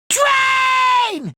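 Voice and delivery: high, drawn-out cartoon yell; stretched high-pitched holler; alarmed cry. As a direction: call out a long, high warning. Sudden warning of a train.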